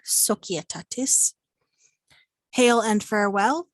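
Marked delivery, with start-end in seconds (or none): 0.0-1.3 Societatis with the C as a K; hard C. Shown as a demonstration. Teacher repeats it for learners, Societatis slow with hard C. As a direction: say "sokietatis" for Societatis, slowly. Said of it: "that C is going to be hard".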